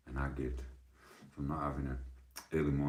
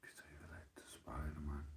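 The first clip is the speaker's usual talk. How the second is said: whisper; 13 dB quieter than usual. Real, not acted. Whispered question.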